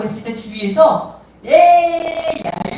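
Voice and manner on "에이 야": scoffing. Retold or imitated. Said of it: "이러고 있을 거예요".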